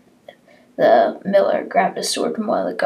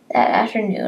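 ordinary delivery

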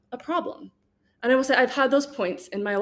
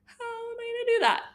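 much higher, incredulous squeal; baffled; 13 semitones higher than usual. Retold or imitated. Stunned by the penance of ten minutes, incredulous and much higher. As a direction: sound stunned by an impossible task. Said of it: "I was like".

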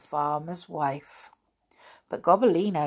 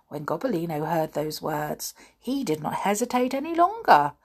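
usual speaking voice

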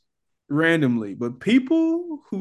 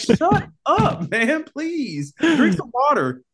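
high, squawked comic plea; exasperated mock outburst; 10 semitones higher, 3 dB louder, much slower than usual. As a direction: beg them to stop, voice way up. Acted outburst at coffee snobs.

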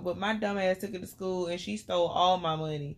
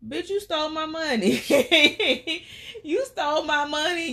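higher, accusing bitch; teen confronting the friend who robbed her; 1 mock indignant; 7 semitones higher than usual. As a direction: call her out, half-laughing. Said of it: "I'm like".

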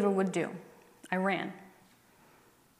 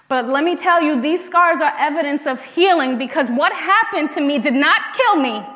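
louder, building testimony cadence; preaching; 3 semitones higher, 4 dB louder than usual. Acted. Speaker testifies to the crowd about her scars, preaching. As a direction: preach it, each line hit harder.

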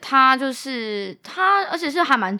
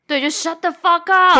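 annoyed retort; 6 semitones higher, 5 dB louder than usual. Retold or imitated. Mimicking annoyed audience.

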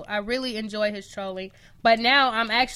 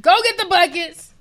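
higher, louder call; loud order; 7 semitones higher, 9 dB louder than usual. Real, not acted. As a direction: shout for the buckets. Repeat joking order.

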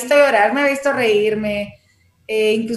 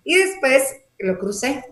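usual speaking voice

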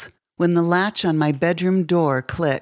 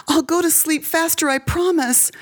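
put-on much higher child's voice, begging; pleading little girl; 11 semitones higher than usual. A narrator's reading. Girl begging her stepfather, high pleading child voice. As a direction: promise anything to make him stop.

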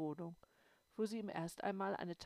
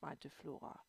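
plain reading voice

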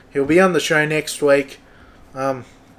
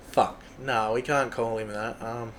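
usual speaking voice

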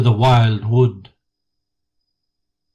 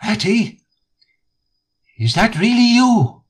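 small, high, hopeful little voice from a hole; a frightened little creature peeping out; feeble voice; 9 semitones higher than usual. A narrator's reading. Mole answering Rat from the hollow tree, high feeble voice. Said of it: "came a feeble voice saying".

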